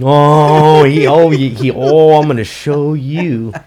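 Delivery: loud, high giddy mimicry with drawn-out ohs; hammed-up giddy glee, high and very loud; giddy imitation. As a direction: act out someone bursting with giddy eagerness. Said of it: "the way he got all giddy".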